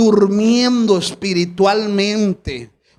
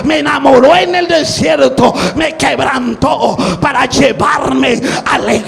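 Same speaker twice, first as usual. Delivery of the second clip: rising, much louder, higher shouted testimony; shouted boast; 3 semitones higher, 5 dB louder than usual. Retold or imitated. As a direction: build to a full preaching shout. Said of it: "sabes qué es lo primero que dice".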